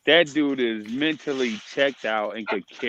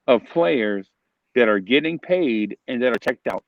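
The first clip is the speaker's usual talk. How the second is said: usual speaking voice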